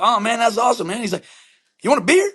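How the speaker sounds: high, eager imitation of a chummy neighbor; mock-excited new buddy; enthusiastic neighbor. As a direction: pitch it right up, offering friendship.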